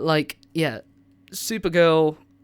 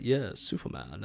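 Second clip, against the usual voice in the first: lower, softer, slow villain growl; deep butch Lex Luthor; 6 semitones lower, 7 dB quieter, slower than usual. Retold or imitated. Vlogger imitates the animated Lex Luthor, deep and slow. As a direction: drop low and slow like a smug villain. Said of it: "where he's deep voice and he's very butch".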